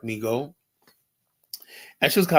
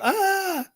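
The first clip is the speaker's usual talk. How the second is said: yelp. Real, not acted. Sudden yelp mid-story.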